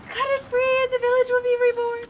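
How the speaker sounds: high, earnest sacred plea; solemn goddess voice. Acted. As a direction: plead like a dying spirit.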